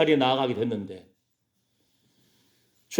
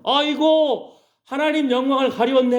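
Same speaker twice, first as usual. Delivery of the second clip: mock lament; 8 semitones higher than usual. Mocking quote of lamenting crowd.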